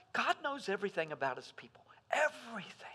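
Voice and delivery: a reverent, whispered emphasis; hushed whisper. Acted. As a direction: whisper it like a secret. Preacher hushes to stress God's knowledge.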